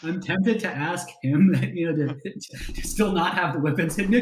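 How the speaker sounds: usual speaking voice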